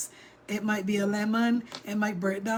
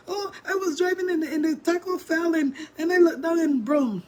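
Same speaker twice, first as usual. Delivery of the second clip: flustered daughter; 6 semitones higher, 4 dB louder than usual. Retold or imitated. Daughter explaining the crash.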